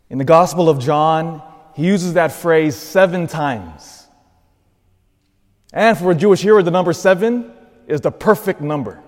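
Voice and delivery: rising, fervent sermon voice; preaching. Real, not acted. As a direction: build the preaching energy. Preaching builds up.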